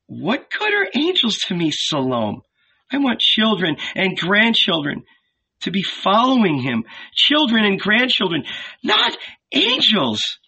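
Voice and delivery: high, plaintive protest; despairing. A narrator's reading. Mary tells Salome she wants grandchildren, despairing. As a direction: protest plaintively, pitch well up. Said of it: "shook her head in despair".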